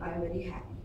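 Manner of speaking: low, quiet, expressionless delivery; lifeless, subdued deadpan. Shown as a demonstration. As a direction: claim happiness with no feeling at all. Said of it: "Otherwise, it's a deadpan expression".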